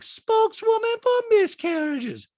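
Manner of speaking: loud, high, slow mockery; Diana. Diana scoffing at Sutton's claim, high mock impression. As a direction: mimic her scoffing up high. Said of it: "Diana's like".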